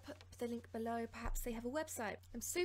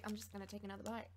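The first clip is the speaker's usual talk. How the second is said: ordinary talk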